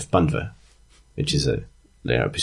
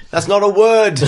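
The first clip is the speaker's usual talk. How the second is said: high, loud mock bellow; ghostly bellow; 7 dB louder than usual. Game master as Aljon's ghost objecting to grammar, bellowing. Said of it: "bellows the ghost of Aljon".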